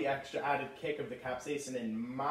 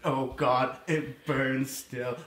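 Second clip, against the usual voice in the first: pained groan; 4 dB louder, much slower than usual. Acted. Still suffering from spray.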